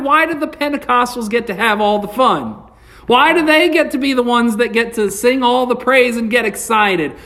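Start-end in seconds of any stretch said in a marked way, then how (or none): none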